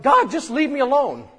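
high, loud fed-up cry to God; flustered mother at the storage unit pleading loudly; exasperated outburst. Retold or imitated. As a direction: throw it up at the sky, out of patience. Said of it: "it's like".

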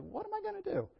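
high, whining cry of a fed-up Moses; whiny Moses. Retold or imitated. Preacher voicing Moses, high and helpless. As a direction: squeak it out like a man at his wits' end. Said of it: "He was like".

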